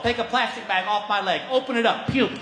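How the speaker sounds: ordinary talk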